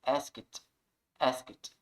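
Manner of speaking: "Asked" as one syllable ending in T. Shown as a demonstration. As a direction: end "asked" on a crisp "skt". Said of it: "mas um som de T". ("asked" com som de T no final)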